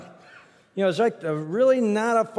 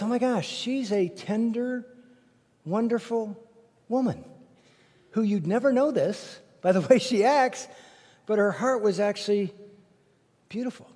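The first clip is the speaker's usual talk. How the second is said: tender wonder; 3 semitones higher than usual. Retold or imitated. Realizing neighbor's tender heart.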